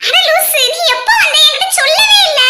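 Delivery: high, bright teasing delight; excited scolding. Acted. Friend excitedly teasing Stella for not telling her she came, in a high voice. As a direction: pretend to scold, bursting with joy.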